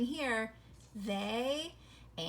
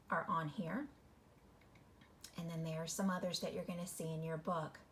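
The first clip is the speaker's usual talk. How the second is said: quiet aside; 5 semitones lower, 7 dB quieter than usual. Real, not acted. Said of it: "unvoiced aside".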